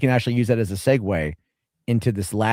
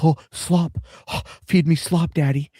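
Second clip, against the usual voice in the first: lightly voiced, higher-pitched begging fans; mock eager audience; 6 semitones higher than usual. Host mocking a YouTuber's audience, hammed-up pleading. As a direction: imitate a drooling, needy fanbase.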